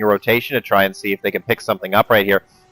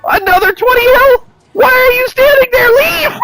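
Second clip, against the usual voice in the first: high-pitched yelling at the screen; excited shout; 5 dB louder than usual. Real, not acted. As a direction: scream at the player to run. Caster yelling at a player.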